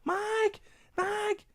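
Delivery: a mock high-pitched call of "Mike" from downstairs; mom yelling upstairs. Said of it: "my mother screaming up the stairs to me".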